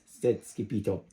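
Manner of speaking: ordinary delivery, nothing marked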